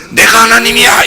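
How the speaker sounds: high-pitched boastful proclamation; hammed-up self-important declaration; mock arrogance. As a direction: imitate someone puffed up with pride.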